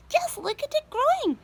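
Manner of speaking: very high, loud, thrilled shriek; excited cry. A narrator's reading. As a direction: squeal it out in delight. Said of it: "Aunt Spiker cried".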